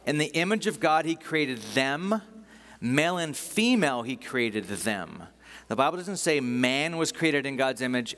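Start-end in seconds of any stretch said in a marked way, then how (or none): 0.1-5.2 measured scripture reading, drawn-out line endings; slow reading. Real, not acted. Preacher reading Genesis to the congregation, slowly. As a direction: read slowly, pausing between phrases. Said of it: "I wanna read this slowly".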